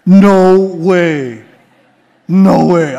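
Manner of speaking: loud, low, stunned "no way"; stunned disbelief. Retold or imitated. A preacher recalling his shock at first reading the hadith, loud disbelief. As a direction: blurt it out like you can't believe what you read. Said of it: "I said, "What?".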